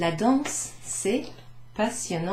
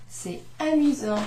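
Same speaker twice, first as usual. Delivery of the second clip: acted amusement; 6 semitones higher, 3 dB louder than usual. Shown as a demonstration. Adjektiv fröhlich vorgespielt.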